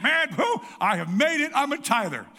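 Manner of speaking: laughing mock boast of having made it; smug tither. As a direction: ham up a proud tither patting himself on the back. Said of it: "and you think".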